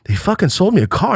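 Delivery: high, gleeful boast; excited teen. Retold or imitated. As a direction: blurt it out proudly. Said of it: "I go".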